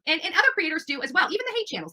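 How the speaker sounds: normal talking voice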